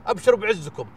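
ordinary talk, nothing marked

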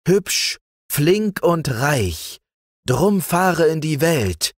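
high, enticing summons, slowing towards the end; beckoning, lifted storybook voice; luring call. A narrator's reading. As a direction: call the boy away into the world. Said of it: "aus welchen es lockte und rief".

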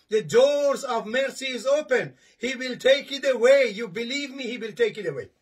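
usual speaking voice

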